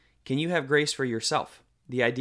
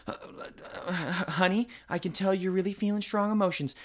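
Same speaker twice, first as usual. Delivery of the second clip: strained calm parent; 7 semitones higher than usual. Retold or imitated.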